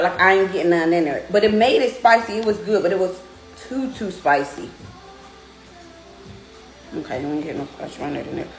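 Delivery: normal talking voice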